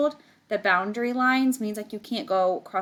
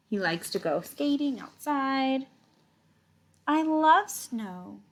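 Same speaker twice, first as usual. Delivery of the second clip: slow, higher picture-book read; story reading; 5 semitones higher, slower than usual. Acted. Teacher reading about Puddle to the class, slow storybook voice. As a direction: linger over each cozy thing he likes.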